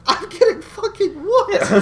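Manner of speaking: very high, loud, slow comic wail; hammed-up fighting back tears, half laughing; mock near-tears. Retelling being beaten before onlookers, mock-tearful wail. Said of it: "fighting back tears".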